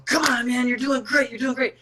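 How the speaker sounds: hammed-up encouragement from the corner; mock cheering. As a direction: pump him up like a cheesy cornerman. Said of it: "negative reinforcement than I do positive".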